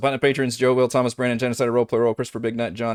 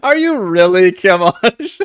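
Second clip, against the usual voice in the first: laughing mock question; 9 semitones higher, 9 dB louder, slower than usual. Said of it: "I was waiting for that".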